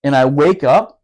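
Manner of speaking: ordinary talk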